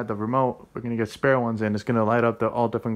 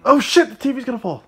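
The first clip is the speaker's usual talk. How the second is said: sudden panicked yell, much higher and louder; alarmed shout; 12 semitones higher, 11 dB louder, faster than usual. Real, not acted. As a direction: shout in real alarm as the TV tips. TV about to fall over.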